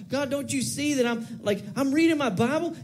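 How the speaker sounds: high-pitched mock protest to God; entitled attitude. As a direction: point out your good deeds, insistently. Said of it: "Maybe not with that attitude".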